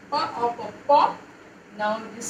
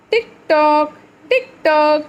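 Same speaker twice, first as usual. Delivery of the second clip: loud, higher sing-song "tick tock"; clock sound; 4 semitones higher, 6 dB louder than usual. Shown as a demonstration. Teacher acting the clock sound.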